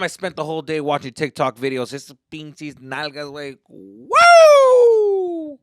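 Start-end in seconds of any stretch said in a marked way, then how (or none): 3.7-5.6 long, high, loud "woo"; excited whoop. Real, not acted. Reacting to a day of TikTok videos, a loud whoop. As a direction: let out a drawn-out high holler.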